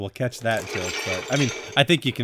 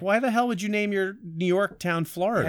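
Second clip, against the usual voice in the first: mock indignant; 8 semitones higher than usual. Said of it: "And I was like".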